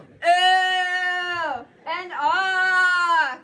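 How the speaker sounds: drawn-out loud crowd ooh and aah. Shown as a demonstration. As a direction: stretch out an impressed ooh, aah. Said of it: "Need all of you to go".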